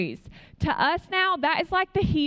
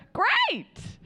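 high-pitched, drawn-out mock delight; exasperated great; 12 semitones higher than usual. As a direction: blurt it in sarcastic frustration. Retells reaction to being refused help.

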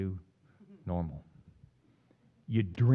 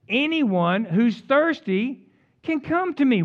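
preached quote; 6 semitones higher, 3 dB louder, slower than usual. Acted. Quoting Jesus with force.

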